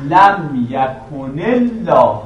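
very slow "لم یکن الله", joined as yakunil-lah; demonstrated joined pronunciation. Shown as a demonstration. Teacher showing students both lams, slow joined "لم یکن الله" with doubled ل. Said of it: "یعنی یه لام ساکن اول تلفظ میکنید".

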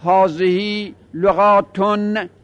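لغاتن drawn out slowly, ending in "-tun"; nominative -un ending. Shown as a demonstration. Teacher modelling the case endings, slow -tun on لغاتن. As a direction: end لغاتن on a clear "-ton/-tun", very slowly. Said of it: "این باید بگیم".

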